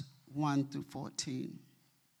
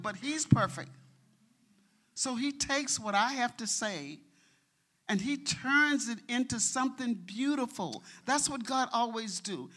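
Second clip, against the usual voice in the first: higher, louder, building testimony; fervent preaching; 7 semitones higher, 6 dB louder than usual. Real, not acted. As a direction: preach it out with full conviction.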